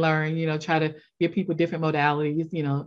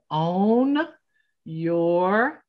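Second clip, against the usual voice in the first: slow emphatic; much slower than usual. Real, not acted. Spelling out her website name.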